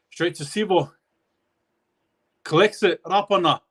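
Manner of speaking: rising play-by-play, urgency mounting toward the line; building excitement. Real, not acted. Final set building to try attempt.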